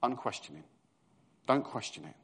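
normal talking voice

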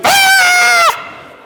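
high sudden shriek of alarm; mock panicked scream from the passenger seat; wife's startled scream. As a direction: shriek at the near miss. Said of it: "we'd be driving along and".

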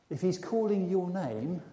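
ordinary talk

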